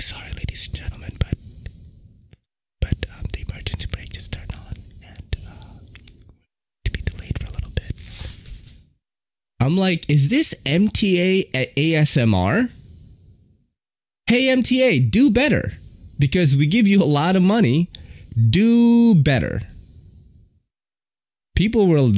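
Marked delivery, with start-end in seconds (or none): 0.0-7.9 unvoiced whispered announcement; whispering conductor. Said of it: "the dude was, like, whispering". 10.1-19.6 mock scolding. Scolding the MTA in story.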